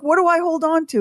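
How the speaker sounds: high frightened squeak of a question; panicked question. Retold or imitated. On the elephant, asking the mahout in high panic. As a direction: ask it in a scared high voice. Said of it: "I was like".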